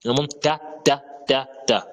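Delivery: ta repeated crisply; letter ta demo. Shown as a demonstration. Teacher repeats ta for students to copy. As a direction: model ta for learners. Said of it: "également le ta".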